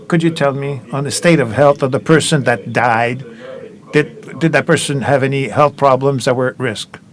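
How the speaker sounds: speaking up. Real, not acted. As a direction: repeat the question up into the mic so it carries. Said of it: "Can you speak louder".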